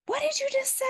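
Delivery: high mock-angry boss voice. Boss storming into the studio, mock-angry high voice. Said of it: "he's like".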